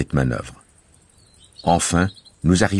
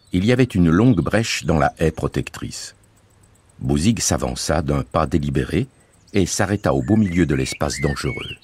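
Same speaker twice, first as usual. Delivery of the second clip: ordinary delivery, nothing marked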